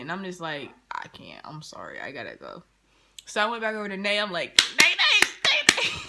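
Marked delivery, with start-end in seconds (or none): 0.9-2.6 very low, soft apology; retold apologetic excuse. Retold reluctant excuse to leave. 4.8-6.0 high, loud mock squeal to a friend; excited call.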